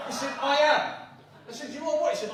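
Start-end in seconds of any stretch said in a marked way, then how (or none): 0.4-0.8 louder, higher insistence; insistent. Retold or imitated. Friend insisting on his answer, louder and higher. 1.7-2.1 higher incredulous question; baffled. Retold or imitated. Asking the friend again, higher and incredulous.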